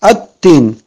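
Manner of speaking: At-tin with a held t and no l; doubled t. Shown as a demonstration. Teacher repeating the correct reading, double t in "At-tin". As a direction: stop on the t, then release it in "At-tin". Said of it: "ça devient tajdid".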